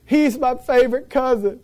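high-pitched mock wail; mock crying. Cousin crying over the injured boy, hammed-up weeping. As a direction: imitate a cousin tearfully lamenting. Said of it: "and they're crying".

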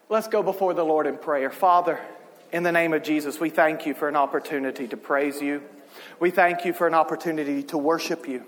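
low, hushed altar-call delivery; subdued, reverent pulpit voice with pauses; pastoral prayer. Real, not acted. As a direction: lead the congregation gently into prayer.

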